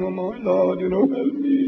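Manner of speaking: high-pitched mock weeping plea; imitated crying prayer. Jesus praying within himself to God, imitated crying voice. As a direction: act out a tearful cry for help, climbing high. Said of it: "he was crying to himself".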